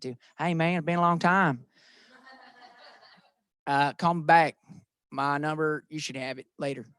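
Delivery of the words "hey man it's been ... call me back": country accent. Shown as a demonstration. Agent showing off a country accent on a voicemail. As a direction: say it like a ranch hand would. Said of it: "I can do a country accent".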